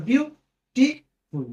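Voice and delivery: "বিউটিফুল" very slowly, a gap between the parts; broken into syllables. Shown as a demonstration. Teacher breaking a word up for students, slow and syllable by syllable. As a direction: split "বিউটিফুল" into বিউ, টি, ফুল. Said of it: "দেখো ভেঙে দিয়েছি".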